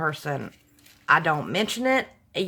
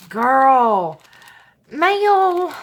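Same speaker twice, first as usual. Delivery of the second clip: usual speaking voice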